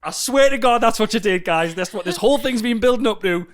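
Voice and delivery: loud, high indignant rant; excited outrage. Real, not acted. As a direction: expose her with glee. Outraged reveal to listeners.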